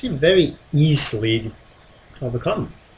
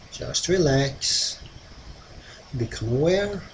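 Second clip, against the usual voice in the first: mesmerizing; 6 dB quieter than usual. Acted. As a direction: lull them into trance, long pauses. Hypnotist leads trance induction.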